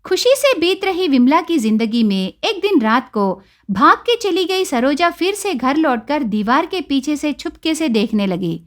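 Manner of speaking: usual speaking voice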